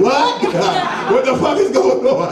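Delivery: put-on high-pitched startled girlfriend; mock shock. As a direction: exclaim it high, in comic disbelief.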